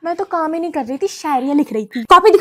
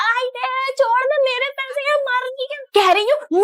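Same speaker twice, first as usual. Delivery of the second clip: put-on child yelping; 8 semitones higher, 4 dB quieter than usual. Child yelps in scuffle.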